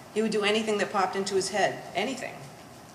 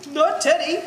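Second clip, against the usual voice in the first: anguished cry; 8 semitones higher, 6 dB louder than usual. Acted. Bitter climax about who will care.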